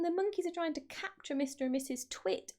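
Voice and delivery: plain reading voice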